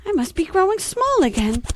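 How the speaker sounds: ordinary talk, nothing marked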